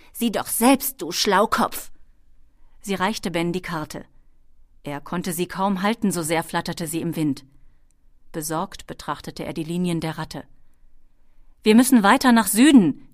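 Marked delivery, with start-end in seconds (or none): 0.1-1.9 higher, louder kobold retort; scornful kobold. A narrator's reading. Kobold hands Ben the map, scornfully. 11.6-13.1 loud, higher insistent cry; calling out. A narrator's reading. Worried boy studying the map, calling out insistently. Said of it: "rief er".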